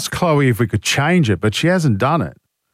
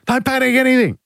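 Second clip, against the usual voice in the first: high, hammed-up politician quote; exaggerated Chris Bowen; 10 semitones higher than usual. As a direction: overblown mock of a minister. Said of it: "he was a little bit more measured than that".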